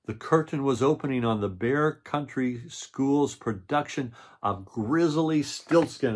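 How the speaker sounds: storybook read-aloud voice; reading to children. A narrator's reading. Reads the picture book aloud.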